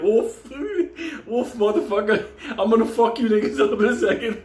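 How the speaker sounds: high, barked comic threat; angry dog's voice. Retold or imitated. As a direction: threaten like a dazed dog, cracking up. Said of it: "he's like".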